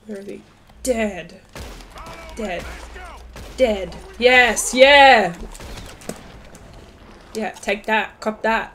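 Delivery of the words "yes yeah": loud celebratory shout; triumphant cheer. Real, not acted. Player celebrating kills, loud triumphant cheer. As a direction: cheer the kill loudly.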